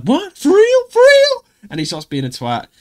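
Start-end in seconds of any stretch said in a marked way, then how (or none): 0.0-1.4 very high, loud squawked disbelief; iShowSpeed. Retold or imitated. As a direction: shriek it like a hysterical streamer impression. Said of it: "the Speed talks".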